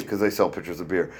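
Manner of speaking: ordinary talk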